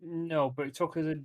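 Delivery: usual speaking voice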